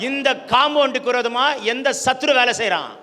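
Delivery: loud, forceful rallying sermon; impassioned preacher's charge; full-force preaching. Real, not acted. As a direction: drive each line home in separate bursts.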